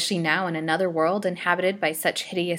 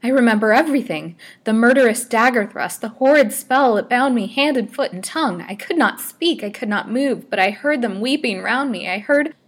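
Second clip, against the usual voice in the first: high, loud frantic outpouring; feverish, impassioned raving; wild, agitated; 6 semitones higher, 9 dB louder than usual. A narrator's reading. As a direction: relive the horror at full voice. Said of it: "continued wildly".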